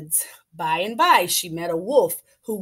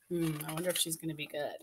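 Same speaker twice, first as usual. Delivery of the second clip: plain reading voice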